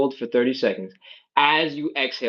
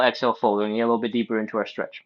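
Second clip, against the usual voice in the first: ordinary talk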